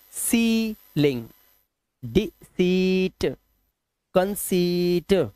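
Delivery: सीलिंग, डिसीट, कंसीट with a slow, stretched "seee"; EI as long "ee". Shown as a demonstration. Teacher demonstrating EI to learners, drawn-out "ee" in सीलिंग, डिसीट. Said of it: "वो ई से करना है".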